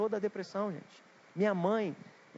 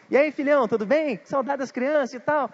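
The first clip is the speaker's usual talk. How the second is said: much higher and louder, a bubbly mom calling her son; cheerful mother; 8 semitones higher, 9 dB louder than usual. Retold or imitated. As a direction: burst in all happy like mom on the phone. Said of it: "já me liga alegrona". (mãe alegre ligando para o filho, bem animada)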